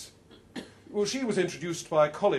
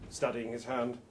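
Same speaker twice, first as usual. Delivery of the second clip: usual speaking voice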